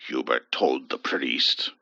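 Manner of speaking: ordinary delivery, nothing marked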